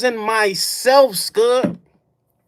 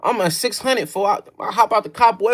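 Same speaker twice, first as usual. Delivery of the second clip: ordinary talk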